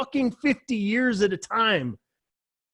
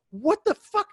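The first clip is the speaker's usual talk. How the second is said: high, incredulous yelp of outrage; mock Republican outrage; 11 semitones higher than usual. Republicans reacting to a Democrat with high mock disbelief. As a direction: squeak out the disbelief like a stunned Republican. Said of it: "the Republicans go".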